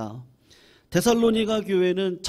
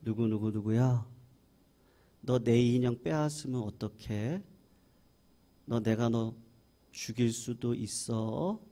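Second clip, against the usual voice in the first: put-on soft, low, slow child's threat; a daughter sweetly whispering a threat over her doll; quiet, sweet menace; 7 semitones lower, 7 dB quieter than usual. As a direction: lower your voice and threaten her gently. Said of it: "소리를 아주 낮추고".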